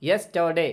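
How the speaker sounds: यस्टरडे as "yes-tuh-day", with a short "a" in the middle; open A vowel. Shown as a demonstration. Teacher repeating the correction for learners, यस्टरडे with "tuh", not "too". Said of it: "बल्कि आ की".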